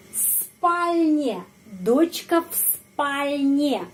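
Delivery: в спальне with в as a voiceless f; slow clear в спальне, в said f. Shown as a demonstration. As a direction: devoice в before спальне, slowly. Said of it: "S, huruf konsonan yang voiceless".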